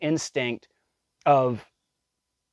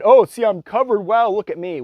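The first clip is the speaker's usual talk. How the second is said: much higher, louder sing-song sneer; mocking mimicry; 9 semitones higher, 7 dB louder than usual. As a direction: parody a smug show-off. Mocking mothers with towels.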